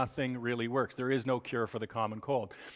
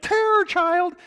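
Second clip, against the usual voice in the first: much higher, louder screech imitating a colicky baby; mock baby scream; 9 dB louder, slower than usual. Lecturer imitating a colicky baby's sudden scream. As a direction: shriek it like a screaming infant. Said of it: "The child will scream and it'll be like".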